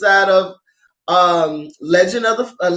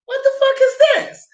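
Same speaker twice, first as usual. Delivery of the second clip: much higher, laughing disbelief; laughing shocked outcry. Retold or imitated. Recalling a man dropping from the ceiling, high laughing outcry.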